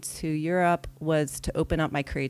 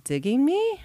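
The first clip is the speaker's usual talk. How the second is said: high, louder giddy question; a thrilled can-it-be; delighted at a cute tour guide's interest; giddy disbelief; 10 semitones higher, 4 dB louder than usual. Retold or imitated. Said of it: "I thought".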